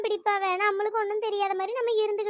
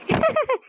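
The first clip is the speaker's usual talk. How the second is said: laugh; 4 semitones higher, 4 dB louder than usual. Acted. Laughs at her own joke.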